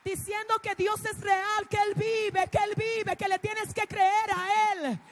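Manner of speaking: loud, soaring sermon climax; fiery preaching. Real, not acted. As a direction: proclaim it to the whole church. Preaching climax at full force. (predicación a todo pulmón)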